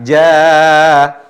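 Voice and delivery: JA held long and loud on an open "a"; thin long alif. Shown as a demonstration. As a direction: sustain "Ja" steadily, no hint of "o". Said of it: "Intonasi panjangnya alif menjadi tipis". (Ja lantang, tipis, ditahan panjang)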